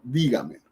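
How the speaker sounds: "dígamelo" con la fuerza en DÍ; acentúa la primera sílaba de "dígamelo"; acento correcto. Shown as a demonstration. ("dígamelo" sobresdrújula, DÍ-ga-me-lo)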